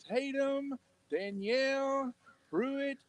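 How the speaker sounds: slow, high, grand announcer reading a name; graduation announcer. Retold or imitated. Father imitating the graduation announcer, slow grand announcer voice. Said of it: "college graduation".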